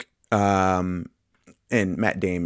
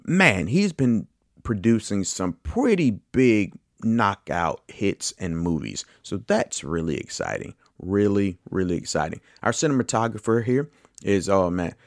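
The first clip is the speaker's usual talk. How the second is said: ordinary talk, nothing marked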